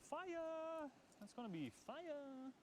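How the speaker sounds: high, excited shout of fire. Real, not acted. Hyping the food, then shouted.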